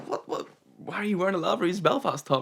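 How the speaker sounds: high, incredulous questioning; baffled disbelief. Retold or imitated. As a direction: demand an explanation in amazement. Acts out disbelief at Canadian's top.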